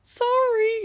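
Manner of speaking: imitating his squeaky childhood apology; squeaky kid voice. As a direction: squeak a timid little apology. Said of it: "I said in a squeaky voice".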